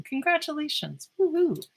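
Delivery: high excited cheer. Real, not acted. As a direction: celebrate with the class. Celebrating the finish.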